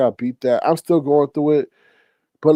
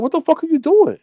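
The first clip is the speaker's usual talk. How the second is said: very high, incredulous demand; shocked outburst; 12 semitones higher than usual. Retold or imitated. What he wishes he had said to the old man, high and incredulous. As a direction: fire it back in disbelief, way up high. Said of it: "I should have said".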